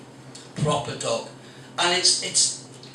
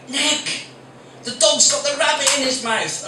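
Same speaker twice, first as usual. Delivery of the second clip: shouting wife; 9 semitones higher, 4 dB louder than usual. Retold or imitated. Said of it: "my wife shouted out".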